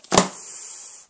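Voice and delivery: loud weary sigh. Real, not acted. Dreading a night of remote programming, heavy sigh.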